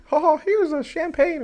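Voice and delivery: very high, squeaky cartoon mouse; Mickey Mouse voice. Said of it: "Mickey, you know, goes".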